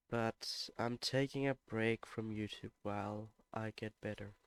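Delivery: ordinary delivery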